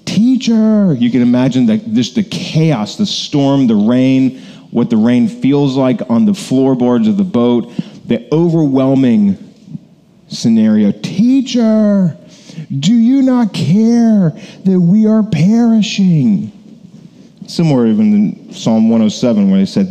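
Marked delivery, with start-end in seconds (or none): 0.0-1.0 high, drawn-out cry for help; yelling out. Acted. Disciples yelling to Jesus in the storm, desperate yell. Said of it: "they yell out. That's why I say it like this". 10.9-16.5 high, drawn-out desperate cry; slow, high-pitched yell over the storm. Acted. Disciples to sleeping Jesus as the boat fills, yelling out. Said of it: "they yell out. That's why I say it like this".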